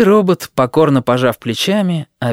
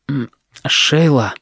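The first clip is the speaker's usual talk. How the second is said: tender; slower than usual. Acted. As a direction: say her name slowly, tenderly. Tender moment.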